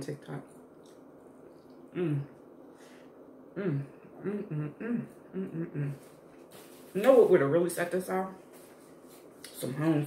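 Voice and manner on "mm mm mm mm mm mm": soft savoring mm; savoring hum. Real, not acted. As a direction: hum with pleasure over food. Tasting food, eating.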